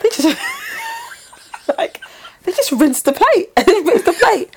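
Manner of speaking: high, laughing disbelief while retelling it; horrified friend. Retold or imitated. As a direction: imitate her shocked account with laughter. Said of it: "She goes".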